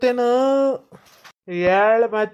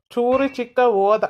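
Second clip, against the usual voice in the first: normal talking voice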